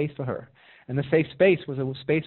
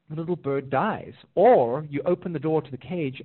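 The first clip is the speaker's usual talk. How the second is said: ordinary talk, nothing marked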